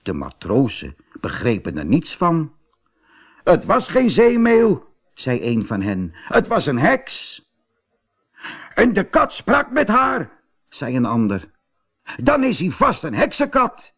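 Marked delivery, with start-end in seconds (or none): 3.4-4.9 high, loud exclamation; excited sailor. Acted. Sailor exclaims after the storm. 6.3-7.5 high-pitched exclamation; excited sailor. Acted. Sailor exclaims about the witch. 8.7-10.3 high-pitched sailor's exclamation; excited sailor. Acted. 12.2-13.9 high-pitched sailor's exclamation; excited sailor. Acted. Sailor concludes in alarm.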